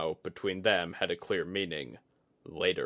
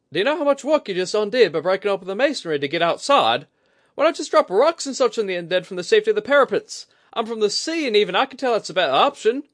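fast, high, loud angry rant; fast, much higher furious lecture; exasperated scolding; 14 semitones higher, 11 dB louder, faster than usual. A narrator's reading. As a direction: rattle off the complaints in frustration. Kudaka scolding Itsuji.

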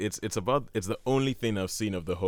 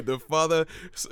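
usual speaking voice

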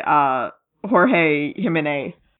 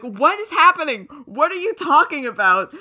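mock bafflement; 6 semitones higher than usual. Baffled aliens' reply, acted.

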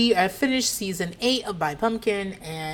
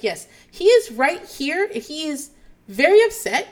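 higher, slow, louder teacher delivery; talking around someone; 8 semitones higher, 6 dB louder than usual. Retold or imitated. Teacher speaking for the student beside her, high mock-earnest relay. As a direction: relay it pointedly for the child listening nearby. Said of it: "she is talking around someone".

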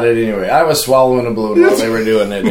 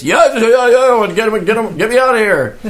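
high, eager yeah yeah get him; rushed, relieved begging to be let out; man relieved to be swapped out, eager plea; eager pleading; 8 semitones higher than usual. Retold or imitated. Guy desperate to escape guillotine.

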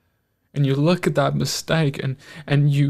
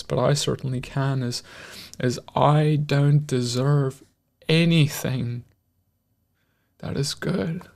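usual speaking voice

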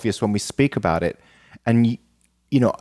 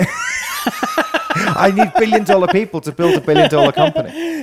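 high-pitched, cracking up through the words; real helpless laughter while talking; laughing; slower than usual. Real, not acted. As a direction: barely get it out through laughter. Repeats the line while cracking up.

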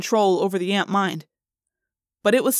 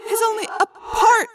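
shattered; 14 semitones higher, 4 dB louder than usual. Acted. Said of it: "sounding shattered".

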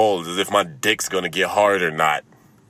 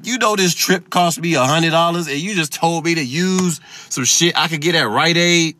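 much higher, louder incredulous rant; mock outrage; 11 semitones higher, 5 dB louder than usual. Comic retelling his furious reply to the doctor, higher and louder. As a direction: sputter in disbelief, then rant. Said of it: "And I'm like".

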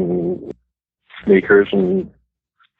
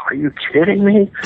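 squeaky, much higher astonished cry; a starstruck fan thinking it to himself, much higher; starstruck disbelief; 13 semitones higher than usual. Retold or imitated. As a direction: inner voice gasping in awe. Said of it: "inside we're going".

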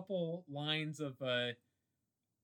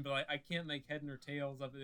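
usual speaking voice

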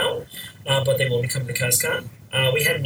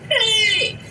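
excited shout; 4 dB louder than usual. Retold or imitated. Retold greeting, spotting a friend.

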